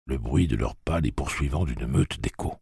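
ordinary delivery, nothing marked

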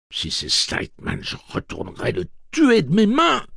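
hushed then screamed threat; furious threat. Acted. Eddie threatens.

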